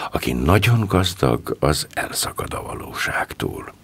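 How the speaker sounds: plain reading voice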